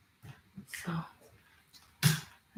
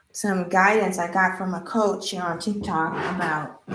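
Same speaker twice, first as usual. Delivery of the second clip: ordinary talk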